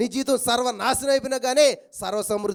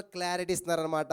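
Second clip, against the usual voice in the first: normal talking voice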